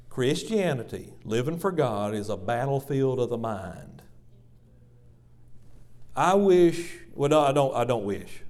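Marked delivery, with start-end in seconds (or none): none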